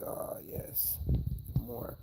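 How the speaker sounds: soft whisper for the dog enjoying a scratch. Pretend. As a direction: breathe it as the content dog. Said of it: "He's like".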